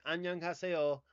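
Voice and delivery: Korean greeting with a southern twang. Shown as a demonstration. Greeting a Korean-speaking friend, southern twang. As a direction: Annyeonghaseyo drawled with a southern twang. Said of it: "every time I say".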